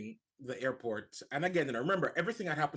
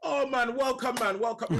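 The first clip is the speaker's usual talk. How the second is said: much higher, louder delighted greeting; excited welcome; 10 semitones higher, 6 dB louder than usual. Retold or imitated. Airport officer welcoming a Jamaican visitor, loud delighted greeting. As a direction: beam out a big welcome, man.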